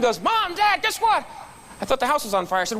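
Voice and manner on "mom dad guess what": put-on much higher, eager kid voice bursting in; a child who can't contain himself; excited little boy. Son bursting into parents' room at dawn, much higher excited kid voice.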